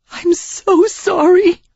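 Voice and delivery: high, slow, tearful gasp; Sarah sobbing her apology. A narrator's reading. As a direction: gasp it out through tears. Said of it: "she gasped".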